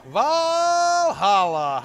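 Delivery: long, high, loud stretched call of the name; drawn-out greeting. Real, not acted. Streamer greeting a viewer arriving in chat, long high drawn-out call. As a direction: sing the name out slowly in welcome.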